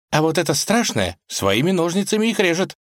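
put-on high, lively girl's voice; young woman voice. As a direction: tell it with spooky relish. Vasilisa voiced in story.

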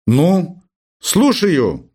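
a touch of fastidious distaste; squeamish disdain. A narrator's reading. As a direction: answer the phone with understated disdain. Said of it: "брезгливо спросил Персиков".

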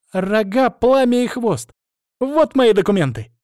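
put-on high, loud girlish bark. Dragon girl answering the demand for papers, barked retort. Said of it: "рявкнула дракоша".

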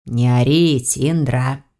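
low hissed rebuke; hissing. A narrator's reading. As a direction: hiss at her to hush. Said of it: "зашипел на неё привратник".

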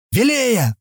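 high, ringing call; thundering call. A narrator's reading. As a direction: call out as if thundering, without full volume. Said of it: "грянул громом родной голос".

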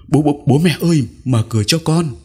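put-on frightened child calling through the door. A narrator's reading. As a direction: call desperately for the parents to open up. Child pounding door calling parents.

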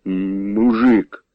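low-key anger, slow stammered word; mock the insult slowly, contained anger; angry. A narrator's reading. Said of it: "гнівно до Юхима".

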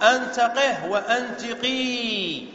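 قه closed on h, then قي held long; contrasted word endings. Shown as a demonstration. Teacher summing up both commands, h on قه and long ee on قي. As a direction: slowly set short h-ending قه against drawn-out قي. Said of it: "قي انتهت بساكن وهو الياء".

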